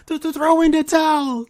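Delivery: loud, high, desperate cornerman yell; stammer, then holler to end the fight; acted movie-style pleading shout; Rocky clip shout. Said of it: "Insert that Rocky clip".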